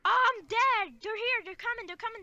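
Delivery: high, urgent rapid-fire alarm, tailing off; frantic in-game alarm, starting loud; panicked gamer. Real, not acted. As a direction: call out the attack in a panic. Panicked play as enemies arrive.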